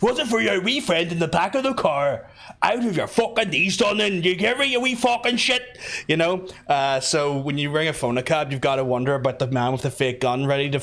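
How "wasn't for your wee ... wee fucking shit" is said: high, hammed-up Belfast threat; menacing Belfast cabbie. Cab driver threatening man over drugs, hammed-up Belfast accent. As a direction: threaten in broad mock Belfast. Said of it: "he whips out the gun".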